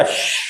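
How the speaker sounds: soft hissed shh to quiet someone; shushing. Retold or imitated. Ravi hushing the little boy beside the bush, soft shh. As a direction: hush the chattering kid with a long shh. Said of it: "शांत कर रहा था बच्चे को".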